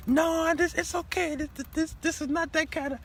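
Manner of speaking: put-on high, loud girlish voice for his daughter; daughter's high voice. Father mimics his daughter brushing him off, high and girlish. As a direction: squeak it out like a teen daughter.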